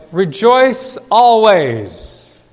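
slow, each word struck apart; staccato command. Shown as a demonstration. Preacher quoting Paul's commands to the church, slow and staccato. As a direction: bark it out like a brief order. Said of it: "all of these staccato commands".

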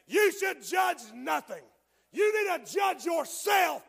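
shouted warning sermon; preaching at full force. Acted. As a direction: pound each line at the congregation.

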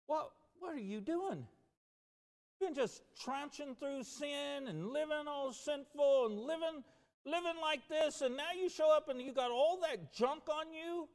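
high, incredulous scolding voice; mock heavenly scolding. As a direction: play someone aghast at the mud. Imagined heavenly rebuke for muddy boots.

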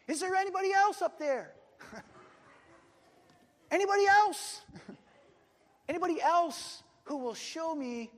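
high, loud comic shout. Acted. Climber seeking someone else up there, shouting up. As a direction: yell upward for some other rescuer. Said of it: "the man shouted up again".

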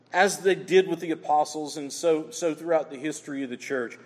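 usual speaking voice

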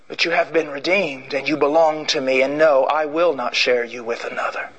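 tender God. Acted. God reassures the redeemed, low and tender. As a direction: speak it as a jealous loving God.

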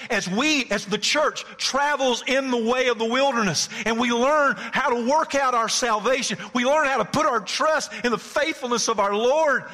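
high, loud, ringing proclamation; preaching at full force. Real, not acted. Preacher rising to a climax before the church. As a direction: preach it to the rafters.

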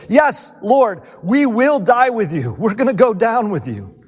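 mock zealous disciples; eager vow. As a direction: swear it with zeal.

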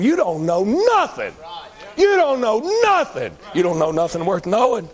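fiery revival preacher rising in pitch; preaching at full force. Acted. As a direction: preach it up to a shout. Preacher hammering the point.

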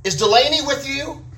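called out high across the house; calling out. Retold or imitated. Husband calls to wife in the bedroom, high call. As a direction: call out to another room, pitch up high. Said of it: "I called out".

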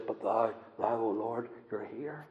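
soft, intimate "you're here"; hushed answer to the rebels' taunts; quiet confident prayer. Acted. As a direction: say it gently to God close at hand. David's quiet reply to rebels.

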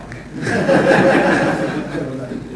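short breathy chuckle. Real, not acted. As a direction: laugh under the breath at the story. Laughing at the nervous tourist.

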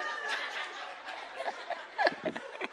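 breathy high laugh at his own joke; airy laugh. Real, not acted. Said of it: "that's a mission trip joke".

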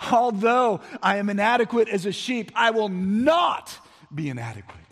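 high, loud defiant shout of the verse; shouting declaration. Retold or imitated. David shouting he will not lack, high and loud. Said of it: "he's saying almost in a shouting tone".

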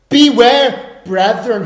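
high, loud proclamation of Beware; preacher calling out to the believers; forceful scripture call. Acted. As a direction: thunder the verse at them.

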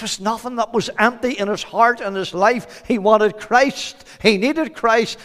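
high, forceful sermon delivery; fervent preaching. Real, not acted. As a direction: preach it out with urgency.

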